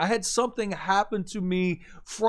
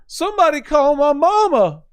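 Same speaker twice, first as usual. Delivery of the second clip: put-on high, loud plea from a scared kid; desperate cry; 9 semitones higher, 10 dB louder than usual. Retelling a handcuffed young man begging for his mother, high and loud.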